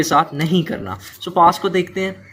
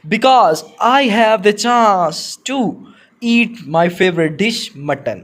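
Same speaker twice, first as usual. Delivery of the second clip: slow, deliberate dictation pace; slow classroom read-aloud; 4 semitones higher, much slower than usual. Shown as a demonstration. Teacher dictates model answer.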